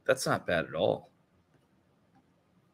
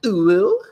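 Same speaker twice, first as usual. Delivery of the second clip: loud high ooh; 12 semitones higher, 11 dB louder than usual. Real, not acted.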